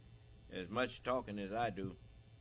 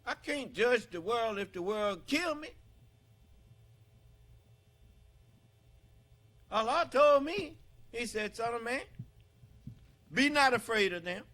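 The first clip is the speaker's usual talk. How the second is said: high, loud, full-force preaching cry; rising sermon shout, hammering each phrase; fiery preaching; 10 semitones higher, 11 dB louder than usual. Acted. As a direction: preach it out to the hall at the top of your voice.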